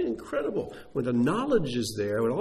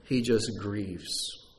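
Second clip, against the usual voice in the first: slow mournful; much slower than usual. Acted.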